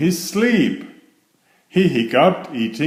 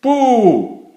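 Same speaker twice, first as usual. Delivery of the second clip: much higher and louder, a sudden boo; shouted boo; 12 semitones higher, 5 dB louder than usual. Acted. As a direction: jump out and shout it. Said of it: "she shouted".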